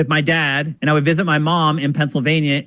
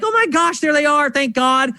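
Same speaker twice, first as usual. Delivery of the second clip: put-on high, relieved cry of kids finding grandparents; hammed-up child relief, much higher; 9 semitones higher than usual. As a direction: gasp it out high like a scared kid who's just been found. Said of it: "And we're like".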